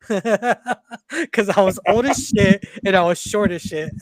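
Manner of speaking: laughing through the words, slow. Real, not acted. Veteran laughing as he tells his crew about his nickname. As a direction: chuckle out the nickname story, drawn out.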